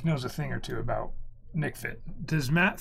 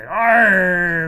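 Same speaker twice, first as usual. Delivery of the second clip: loud growling pirate arr; 5 semitones higher, 14 dB louder than usual. Pretend. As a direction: bellow it like a pirate. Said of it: "you're supposed to say".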